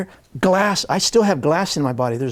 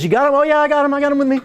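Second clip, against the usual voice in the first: mother fussing, pitched higher; a mom checking on her son, a bit higher; mom's voice; 9 semitones higher, much faster than usual. Retold or imitated. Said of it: "Mom says".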